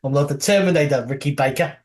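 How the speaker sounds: hammed-up New Zealand accent that drifts Australian; lightly put-on Kiwi voice. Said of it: "trying to do a Kiwi accent".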